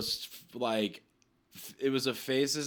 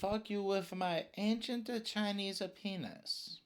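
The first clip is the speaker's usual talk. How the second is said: mock Chinese accent; 7 semitones higher, 4 dB quieter, slower than usual. It's bad, but that's what.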